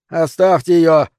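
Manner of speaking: shouted order over a storm; shouting. Acted. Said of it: "прокричал Герлиц". (громкий крик)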